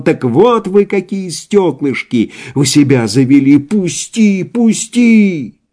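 high struggling yell; frantic shouting. A narrator's reading. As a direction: fight and scream to be freed. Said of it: "кричал". (отчаянный крик)

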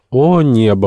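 high, loud outcry; exclaiming. A narrator's reading. Mrs Weldon reacts to the insect hopes, exclaiming. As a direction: exclaim in startled disbelief. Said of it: "воскликнула миссис Уэлдон".

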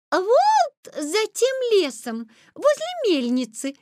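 put-on high, slow girlish voice. A narrator's reading. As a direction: point the way in high, unhurried tones.

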